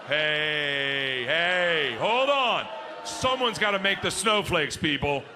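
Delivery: a hint of a coach stopping play; lightly voiced coach, hey hey hold on; coach calling out.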